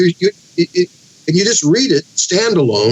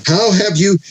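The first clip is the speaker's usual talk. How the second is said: higher-pitched mock admiration; bitter sarcasm; 6 semitones higher than usual. Job answering his friend Bildad, bitterly sarcastic. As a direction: quote it as a sarcastic question. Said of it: "Job just pours on bitter sarcasm".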